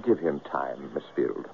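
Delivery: low, soft whisper; hushed reply. Acted. Hunter answers her secret softly, hushed. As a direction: keep it down between the two of them.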